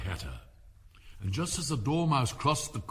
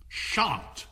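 higher curt refusal; cook's refusal; 6 semitones higher than usual. Acted. Cook refuses the king with one curt word. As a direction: refuse flatly. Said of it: "said the cook".

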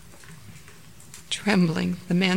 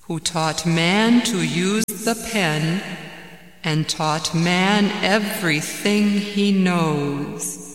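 very slow, solemn intoning; mysterious commanding voice; 3 dB louder, much slower than usual. Acted. Voice in the cave recites to the man, solemnly commanding. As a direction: draw out each phrase as a resounding proclamation. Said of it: "And the voice said".

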